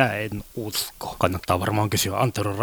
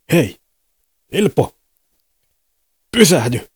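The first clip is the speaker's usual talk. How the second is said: loud, higher call to stop him; 6 semitones higher, 9 dB louder than usual. Acted. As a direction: shout after Ilpo to stop. Calling out to Ilpo. (huutaa Ilpoa pysähtymään)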